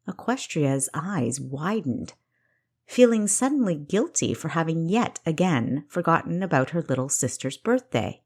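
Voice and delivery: ordinary delivery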